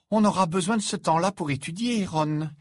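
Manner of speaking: high-pitched character voice, reproving; reproving schoolgirl. A narrator's reading. Hermione to Ron about studying, reproving. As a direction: pitch it up and chide him.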